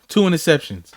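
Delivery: ordinary delivery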